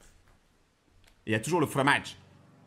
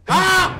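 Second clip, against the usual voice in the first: startled scream; 10 semitones higher, 8 dB louder than usual. Real, not acted. Startled by jump scare.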